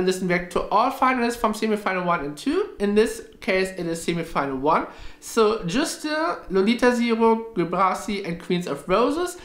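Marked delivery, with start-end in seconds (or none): none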